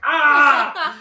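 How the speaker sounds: loud, high excited yelp; excited scream. Retold or imitated. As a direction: shriek with delight. Reacting to a big promo moment.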